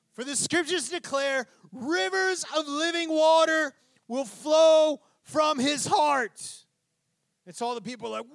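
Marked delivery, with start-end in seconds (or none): 1.7-6.7 slow, high scriptural declaration; ringing, drawn-out shout; shouted proclamation. Acted. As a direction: cry it out over a great crowd. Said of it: "this is what Jesus was doing".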